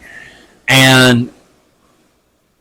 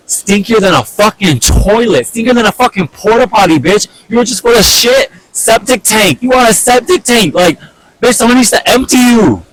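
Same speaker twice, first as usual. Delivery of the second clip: retold insults, pitched much higher and mocking; reliving the rant, higher and mocking; mock furious rant; 11 semitones higher than usual. Retold rant insulting her father.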